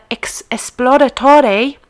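esploratore slow, ringing out on "tore"; Italian pronunciation. Shown as a demonstration. Host giving the Italian answer, esploratore slow and emphatic. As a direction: sound out esploratore in Italian, final e voiced. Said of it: "is Italian".

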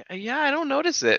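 high-pitched, put-on reply; mock high character voice; Chi-Chi impression. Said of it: "Chi-Chi's like".

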